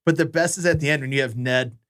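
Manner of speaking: ordinary talk, nothing marked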